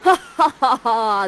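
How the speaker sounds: loud hammed-up villain laugh; evil cackle. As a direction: cackle in triumph like a stage witch. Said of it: "does an evil cackle".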